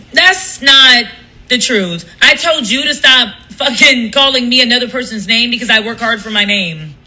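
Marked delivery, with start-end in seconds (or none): none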